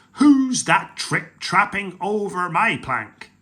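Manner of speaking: slow, loud mocking taunt; pirate chief mocking the troll on the plank; jeering. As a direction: jeer at the troll. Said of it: "jeered Hank Chief".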